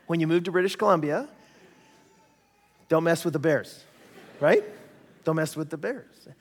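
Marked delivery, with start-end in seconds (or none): none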